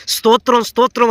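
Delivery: స్తోత్రం called out high, twice; modelled praise cry, pitched high; fervent praise. Shown as a demonstration. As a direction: lift your voice in praise. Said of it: "అని చెప్పు".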